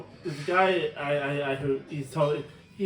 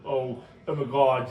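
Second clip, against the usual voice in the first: put-on slow, affected Dublin 4 accent; fake D4 accent; 3 semitones lower, 3 dB louder, slower than usual. Mocking how a guy with fake D4 talks, affected accent. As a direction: drag it out in mock D4. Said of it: "his D four accent is so".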